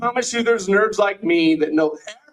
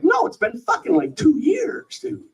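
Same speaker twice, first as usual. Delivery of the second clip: mock indignant retort; 9 semitones higher than usual. Retorting to friend's greeting.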